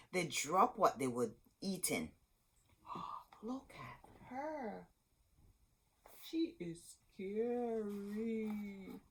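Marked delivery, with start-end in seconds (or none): 2.9-9.0 gasp, then a slow, soft scared whisper; frightened whisper. A narrator's reading. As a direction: whisper it like a terrified child. Children frightened by the witch.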